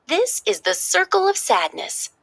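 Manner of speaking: chirpy upbeat Joy laying out the plan; cheerful Joy. Acted. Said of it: "Joy explained".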